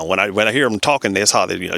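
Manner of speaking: ordinary talk, nothing marked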